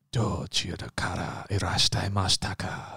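low, soft, sultry foreign-language line; mock-seductive Japanese. Trying Japanese dirty talk on a partner, low mock-seductive. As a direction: make a mundane question sound like dirty talk. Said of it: "Oh, that's kinda hot".

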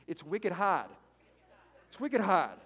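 lightly imitated Boston accent; New England accent, falling at the end; Massachusetts accent. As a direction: put on a bit of a Massachusetts accent. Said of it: "particularly people from Massachusetts".

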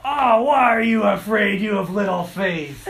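loud, high, harsh telling-off; scolding rebuke. Retold or imitated. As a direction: bark it like a stern scolding. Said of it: "And I didn't say".

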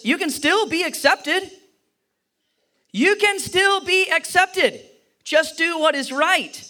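high, slow tender plea; God gently urging Cain; pleading. Acted. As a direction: plead gently for him to come back.

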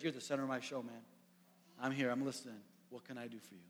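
soft, quiet reassurance; Jesus gently reassuring the overlooked beggar. Retold or imitated. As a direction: speak gently to lift him up.

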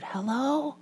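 put-on high, soft child whisper; whispering little boy. Little boy answers a telemarketer's call, whispering. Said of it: "still whispering, the little voice said".

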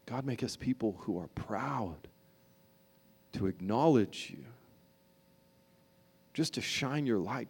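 low, hushed, earnest prayer; soft prayer. Acted. Pastor praying for the congregation, hushed and earnest. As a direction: pray it quietly over the room.

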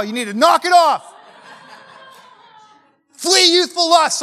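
playful fire-and-brimstone yell; mock scolding shout. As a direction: bark it at them like a stern revival preacher, slowing on the last words. Preacher scolding the congregation.

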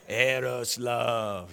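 Eros with a trilled R, slow and low; rolled R eros. Shown as a demonstration. Pastor demonstrates the rolled R, slow and low. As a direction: stretch out Eros, roll the R. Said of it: "Roll your R a little bit".

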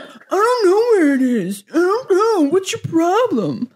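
wife mocking her husband's whiny defensive reply. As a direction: whine it like a put-upon child.

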